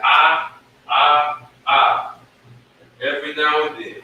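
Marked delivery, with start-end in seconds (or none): 0.0-2.2 ah held open and loud; teacher drilling the ah sound. Shown as a demonstration. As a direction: sing out the ah vowel. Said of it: "we have the ah".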